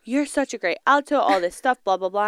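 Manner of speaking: ordinary delivery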